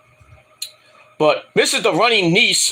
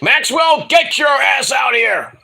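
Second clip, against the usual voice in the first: calling out shout; 7 semitones higher, slower than usual. Retold or imitated. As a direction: yell the name out to summon him. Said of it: "and he's like".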